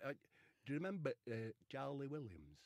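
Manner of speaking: ordinary talk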